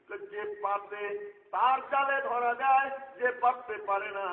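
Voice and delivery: loud, high, slowed preacher's cry; preaching at full force. Acted. Preacher calling out to the gathering in a loud, high cry. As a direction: lift the crowd with a slow, loud call.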